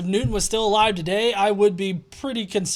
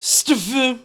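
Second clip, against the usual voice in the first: louder, odd drawn-out "Stufu"; mock comment word; 2 semitones higher, 3 dB louder than usual. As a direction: say "Stufu" like a drunk troll.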